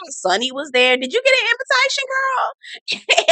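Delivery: put-on high, sing-song gossipy girlfriend; mock gossip voice. Mocking a castmate bragging about the party. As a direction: lay on the fake sweetness.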